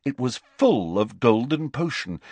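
ordinary delivery, nothing marked

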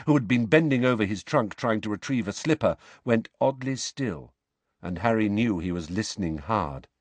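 ordinary delivery, nothing marked

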